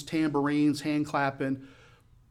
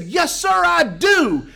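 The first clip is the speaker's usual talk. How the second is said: slow, high, drawn-out shout; shouted reply; 11 dB louder, much slower than usual. Retold or imitated. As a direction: shout back with total conviction. Shouted reply in excited exchange.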